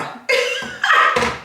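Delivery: high, wheezy real laughter; laughing. Real, not acted. Vlogger admitting she stopped talking to the guy, laughing.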